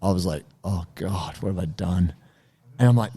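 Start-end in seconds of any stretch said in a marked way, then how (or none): 0.6-2.1 dismayed. Retold or imitated. Realizing partner is injured.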